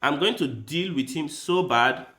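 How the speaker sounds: normal talking voice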